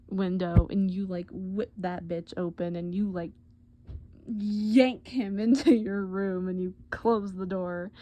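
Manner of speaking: ordinary delivery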